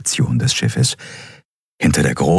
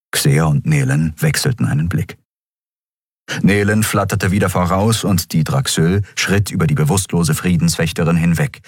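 ordinary delivery

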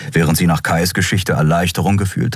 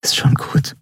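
whispering; 10 semitones higher than usual. A narrator's reading. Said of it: "flüsterte sie".

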